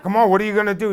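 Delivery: very high, loud goading yell; screaming taunt. Retold or imitated. Bully screaming in the kid's face, high loud dare. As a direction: push him to fight with a shouted dare. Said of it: "He's just screaming in my face".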